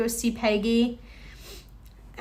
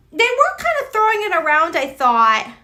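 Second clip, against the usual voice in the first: ordinary talk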